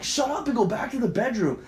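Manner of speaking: high, agitated order; acted angry, rattled command; furious. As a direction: snap an urgent command at partner. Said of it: "I'm fucking furious and I'm shaking".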